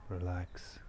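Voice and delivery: calm, soft meditation voice with long pauses; guided meditation. Real, not acted. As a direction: guide listeners gently into stillness, leaving long silences. Leads a guided nature meditation.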